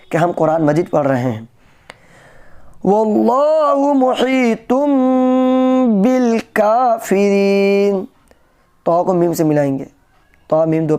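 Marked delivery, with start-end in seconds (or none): 2.9-8.1 melodic tajweed chant; Quran recitation. Acted. Recites verse.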